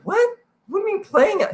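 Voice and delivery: high, baffled squeak; incredulous what. Retold or imitated. Player mocks his own baffled reply to a teammate. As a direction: shoot the voice up in disbelief. Confused reaction to being told play.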